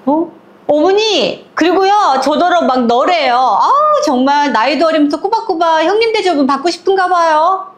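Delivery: high, whiny complaint to mother-in-law; gleeful tattling. Retold or imitated. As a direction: tattle with relish. 동서가 신나서 고자질함. (높고 신난 목소리로 고자질하는 동서)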